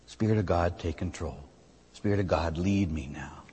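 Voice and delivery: soft, low, hushed prayer; a low murmured surrender; quiet prayer. Shown as a demonstration. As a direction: pray it softly as if alone with God. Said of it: "I surrender to God's Holy Spirit".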